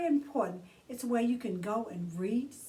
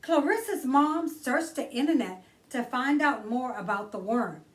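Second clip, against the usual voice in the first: put-on bright read-aloud for children; gentle children's book narration; storybook reading; 4 semitones higher, 5 dB louder than usual. A narrator's reading. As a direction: read it like story time for kids. Reads picture book aloud.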